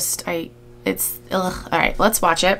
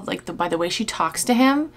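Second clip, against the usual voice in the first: ordinary talk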